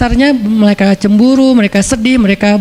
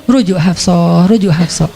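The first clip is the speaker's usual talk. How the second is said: slow, lower, gentle instruction; 5 semitones lower, slower than usual. Acted. Jibril telling the Prophet to take Hafsah back, slow. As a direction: deliver it slowly and gently.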